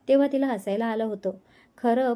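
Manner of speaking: ordinary delivery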